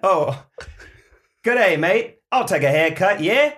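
put-on high, loud hammy Australian accent. Milkman joking in an improv skit, Australian accent. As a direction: say it like an Aussie cliché. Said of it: "two accents in one".

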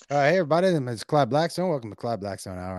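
lightly voiced radio call-in host persona; Clyde Blackstone. Host greeting the audience of his show, lightly voiced persona. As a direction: open the show in a lightly played host character.

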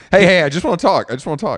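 high, hurried plea; mock insistent approach. Co-host playing man chasing a swimmer, high hurried mock reassurance.

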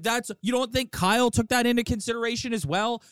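ordinary delivery

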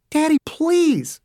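put-on high, drawn-out girlish plea; pleading daughter. A narrator's reading. Daughter to her raging father, pleading. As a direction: beg a parent to stop, stretching it out.

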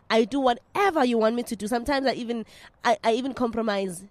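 ordinary delivery

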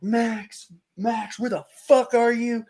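high-pitched calling out for a missing buddy; retold yelling. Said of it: "I'm, like, yelling at these guys".

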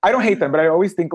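normal talking voice

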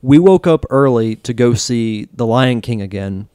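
ordinary delivery

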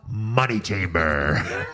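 low, slow, drawn-out title; stretched-out, deep mock drama; mock announcement. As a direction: intone it like a trailer voice. Mock announcement of the flubbed name.

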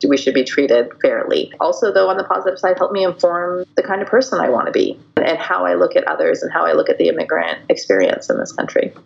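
usual speaking voice

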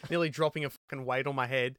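normal talking voice

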